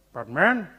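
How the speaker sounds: a hint of an old statesman chiding; Nelson Mandela. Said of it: "He says".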